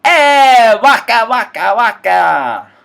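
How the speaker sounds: very high, loud cartoon bear shout; squeaky excited muppet catchphrase. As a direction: belt it out like a goofy puppet comic. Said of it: "Muppets Most Wanted".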